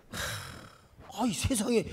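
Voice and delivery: ordinary talk, nothing marked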